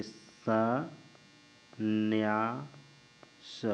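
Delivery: संन्यास drawn out very slowly, न्य clear; slow dictation. Shown as a demonstration. Teacher dictating संन्यास slowly for students. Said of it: "देखिए".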